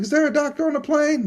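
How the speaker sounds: high, urgent mock call over the cabin; flight attendant call. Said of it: "somebody was like".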